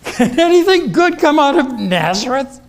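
high, loud, sneering put-down of a small town; scornful doubt. Retold or imitated. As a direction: scoff at Nazareth with a laugh. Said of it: "with a kind of local prejudice".

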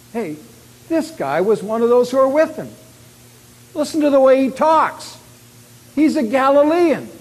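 high, loud pointing-out; accusing call. Acted. Bystander exposing Peter as a Galilean, loud accusation.

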